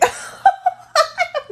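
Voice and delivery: high forced laugh again; loud hollow laugh on cue; fake laugh. Shown as a demonstration. Demonstrating the second delayed fake laugh, high. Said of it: "laugh again".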